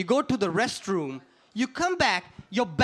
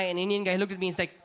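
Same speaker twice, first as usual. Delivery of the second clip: ordinary talk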